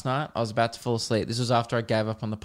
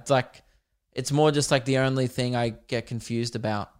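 usual speaking voice

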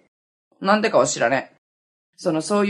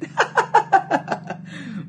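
burst of laughter; 7 semitones higher, 3 dB quieter than usual. Real, not acted. Laughing at her own demand.

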